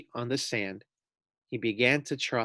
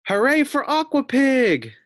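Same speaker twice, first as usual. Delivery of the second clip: much higher, loud cheering yell; crowd yelling; 13 semitones higher, 8 dB louder than usual. Acted. Crowd yelling for Aqua Pig, high and loud. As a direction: belt it like a whole stadium cheering. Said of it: "yelled the crowd".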